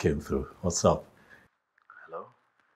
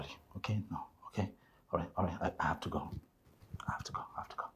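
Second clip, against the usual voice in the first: hushed; 9 dB quieter, much faster than usual. Acted. Hushed goodbye, investors arriving.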